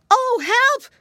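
very high, loud cry for help. A narrator's reading. Curly caught under the bear's paw, crying out. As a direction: squeal it out in alarm. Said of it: "cried Curly".

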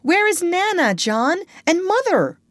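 put-on very high, loud small boy; crying out. A narrator's reading. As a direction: wail for your brother to wake. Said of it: "Michael would cry".

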